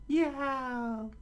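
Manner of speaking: very high yelled yeah; scream. Acted. Luffy keeps screaming, very high yell. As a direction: shriek it out.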